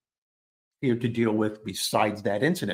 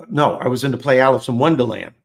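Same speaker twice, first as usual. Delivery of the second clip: louder, quicker self-correction; emphatic correction; 7 dB louder than usual. Real, not acted. Correcting his own story to the listeners, loud and quick. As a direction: blurt the right title out to fix the mistake. Correcting himself about the play.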